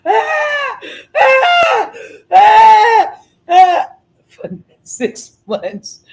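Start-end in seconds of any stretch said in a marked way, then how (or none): 0.1-3.9 very high, loud crying wails; mock baby cry, high and loud; imitated baby wail. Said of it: "I filmed this boy sitting there just".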